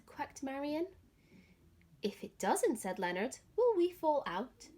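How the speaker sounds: put-on gentle, expressive read-aloud for children; lively picture-book reading; story narration. A narrator's reading. Teacher reads story to children.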